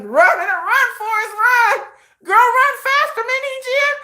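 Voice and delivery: high sing-song taunt to run; taunting cheer. Pretend. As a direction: egg her on mockingly. Taunting the fleeing executive.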